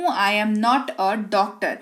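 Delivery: slow, even, word by word; slow model sentence. Shown as a demonstration. Teacher modelling the English sentence slowly. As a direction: read it slowly so students follow. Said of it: "मैं doctor नहीं हूँ".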